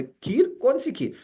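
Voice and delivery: high, innocent who-me surprise; feigned surprise. Retold or imitated. Guest feigns ignorance at party.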